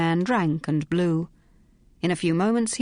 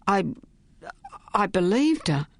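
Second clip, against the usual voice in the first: stammering; 2 semitones higher than usual. Acted. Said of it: "stammered Ellis".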